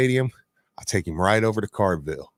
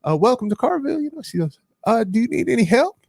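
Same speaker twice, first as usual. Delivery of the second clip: shop clerk voice; 11 semitones higher, faster than usual. Retold or imitated. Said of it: "the woman that's working there goes".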